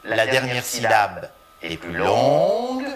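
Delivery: ordinary talk, nothing marked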